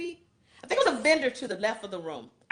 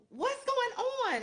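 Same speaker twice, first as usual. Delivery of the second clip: puzzled question; 14 semitones higher, slower than usual. Retold or imitated. Asks frustrated staff what's wrong.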